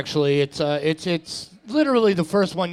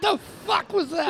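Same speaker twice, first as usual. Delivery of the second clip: high, startled outburst; shocked by the weird noise; stunned reaction; 13 semitones higher than usual. Real, not acted. Reacts to partner's odd laugh.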